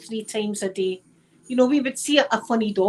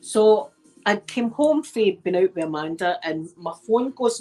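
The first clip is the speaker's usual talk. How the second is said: usual speaking voice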